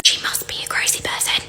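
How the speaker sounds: conspiratorial whisper; whispered aside. Real, not acted. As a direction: drop to a whisper, as if sharing a secret. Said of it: "whispered aside".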